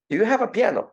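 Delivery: high-pitched, playful doorstep question; cheeky salesman. Retold or imitated. Salesman measuring the wall asks homeowner, mock-innocent. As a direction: pop the absurd question in a high voice.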